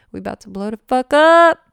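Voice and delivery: high, loud victorious cry; triumphant shout. Real, not acted. Telling viewers her career is exploding, triumphant shout. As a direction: burst out in triumph. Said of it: "I'm just like".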